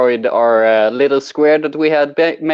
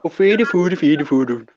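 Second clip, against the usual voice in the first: mock Swedish chef; slower than usual.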